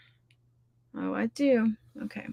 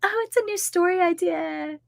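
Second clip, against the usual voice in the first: high, bright mock-giddy thrill; past excited self; 9 semitones higher than usual. Recalling being a new writer, a high mock-giddy self-quote. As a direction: play your younger writer-self, dazzled by a new idea. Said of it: "it was like".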